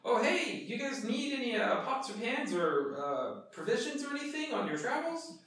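high-pitched peddler's patter; high, eager traveling salesman; merchant hawking wares. Acted. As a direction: pitch your goods to passing travelers. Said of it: "hawking his wares as he's traveling".

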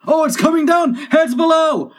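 very high, loud alarm; urgent high-pitched cry; shouted warning. Acted. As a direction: yell a warning to those below. Warning as slate falls.

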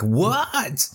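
high, drawn-out incredulous "what"; baffled viewer's high-pitched reaction; mock disbelief. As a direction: voice swoops up in puzzled shock. Acts out viewer's shocked reaction.

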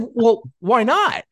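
high, slow, faux-puzzled question; playful high faux surprise; mock innocence. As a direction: feign surprise, drawing it out. Mock-innocent retort to friend.

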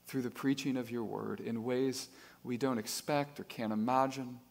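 soft, hushed pastoral prayer; closing prayer, softly prayed. Acted. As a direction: pray it quietly and reverently. Closing prayer before sermon.

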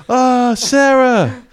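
high, loud, slow wail of a game guard; NPC discovering bodies. Retold or imitated. Guard NPC finding every body in the room, wailing high and loud. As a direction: cry out each name as a guard stumbling on the dead. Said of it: "do his sort of discover body routine".

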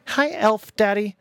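high, slow singsong tease; sly high taunt, stretched slow; mocking taunt. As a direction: drag it out to needle him. Son mocking father.

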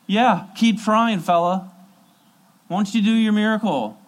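high, loud jeering taunt; mocking onlookers. Crowd jeering at Jesus in a high, mocking voice. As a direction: sneer it at the man on the cross. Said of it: "And they're saying".

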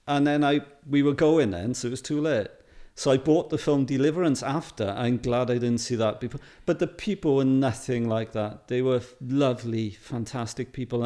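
usual speaking voice

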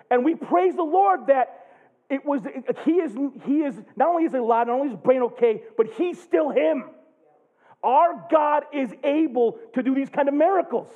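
high, loud fervent preaching; full-force preaching. Real, not acted. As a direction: preach it with all you've got.

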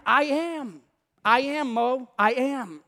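God firmly insisting on I AM; emphatic insistence. Acted. God insists on his identity.

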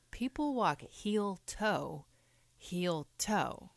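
slow, deliberate heel toe; slow demo. Shown as a demonstration. Friend slowly demonstrating how people walk. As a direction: spell it out slowly, like a lecture. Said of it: "see, Roberta".

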